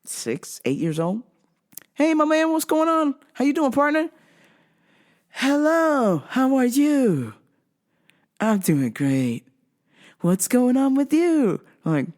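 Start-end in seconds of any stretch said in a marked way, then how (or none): 1.9-4.1 high, bright upbeat hello; higher playful greeting to a child; cheery greeting to a kid. Retold or imitated. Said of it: "when I meet his kid". 5.3-11.6 slow, sing-song stiff replies; imitating an eerily polite boy; robotic polite kid.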